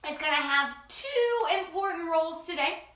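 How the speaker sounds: ordinary talk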